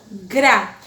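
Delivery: Grá with a rolled, scratchy R; scratched R. Shown as a demonstration. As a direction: trill the R in Grá. (Grá com o R arranhado)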